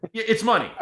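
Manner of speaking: exasperated mock shout; defensive insisting. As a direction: insist loudly as the annoyed customer. Acting out the customer insisting again.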